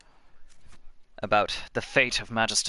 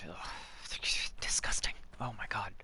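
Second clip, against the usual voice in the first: whispered in disgust; 10 dB quieter, faster than usual. Real, not acted. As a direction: breathe it out, appalled. Disgusted at slaver character.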